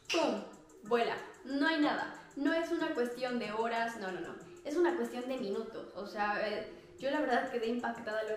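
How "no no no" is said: usual speaking voice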